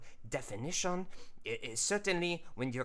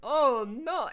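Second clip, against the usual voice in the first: high loud cry of dismay; panicked cartoon; 10 dB louder, much slower than usual. Artist voicing the frame's shock, high and loud. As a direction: yelp it like a startled cartoon.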